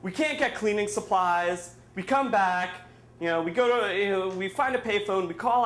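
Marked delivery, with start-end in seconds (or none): none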